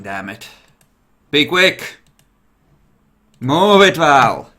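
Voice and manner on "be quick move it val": loud, urgent hurry-up call; higher, raised-voice order. Real, not acted. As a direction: yell at someone to get moving. Hurrying someone along, calling out.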